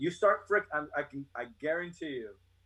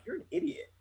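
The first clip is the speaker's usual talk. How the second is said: high-pitched exasperated put-down; mock disbelief; 12 semitones higher, 4 dB quieter than usual. Looking at an educated reporter, high incredulous mock put-down.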